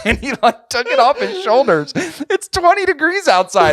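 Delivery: high, loud laughing retelling; squealing through real laughter; incredulous laughter. Real, not acted. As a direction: crack up while telling it, voice climbing. Teller cracking up at the absurdity.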